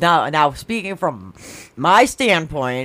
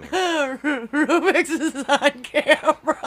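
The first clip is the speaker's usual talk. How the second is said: laughing; 12 semitones higher, much slower than usual. Real, not acted. Laughing hard at the joke.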